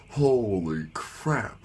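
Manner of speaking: very slow, drawn-out mock shock; stunned reaction. Dreamer watches Wednesday get shanked, drawn-out hammed-up shock. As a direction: stretch both words out in exaggerated disbelief.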